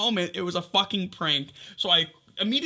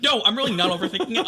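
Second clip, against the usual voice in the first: frantic protest; 5 semitones higher, 5 dB louder, faster than usual. Retold or imitated. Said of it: "I'm like".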